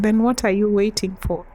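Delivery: normal talking voice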